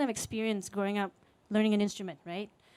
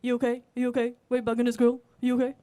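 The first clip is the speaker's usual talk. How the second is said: lightly voiced officer, a bit louder; police officer; 4 dB louder, faster than usual. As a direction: confront him like a police officer. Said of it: "this police officer goes up to this man and says".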